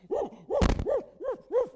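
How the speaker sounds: loud high imitated barks; dog barking. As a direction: bark like an embarrassed guard dog. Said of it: "he'll run out just".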